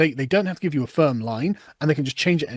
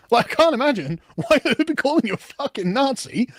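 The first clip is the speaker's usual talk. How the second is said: laughing sarcasm; 6 semitones higher than usual. Real, not acted.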